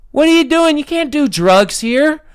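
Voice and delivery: high, loud put-on voice scolding them; mock stern scolding. Guard scolding tweakers outside an apartment, high mock-stern voice. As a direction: play the security guard telling them off.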